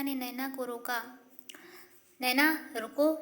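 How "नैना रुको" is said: loud call to stop her; firm, loud call. A narrator's reading. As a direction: call her name across the room. Teacher calls student to stop.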